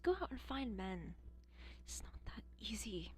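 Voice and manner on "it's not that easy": whispered self-reply. Real, not acted. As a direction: murmur it under your breath.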